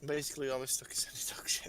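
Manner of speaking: whispered confession with a laugh; sheepish whispered admission. Real, not acted. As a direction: laugh and whisper the embarrassing bit. Confessing embarrassing story.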